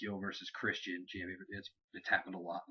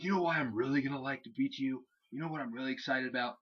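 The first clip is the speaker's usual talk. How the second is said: mock eager taunt; 4 semitones higher than usual. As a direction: imitate a wrestler relishing the challenge. Retold wrestler's eager taunt.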